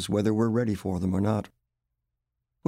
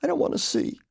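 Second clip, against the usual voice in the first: high, small, fragile voice; a little voice, pitched well up. A narrator's reading. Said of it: "in a small voice".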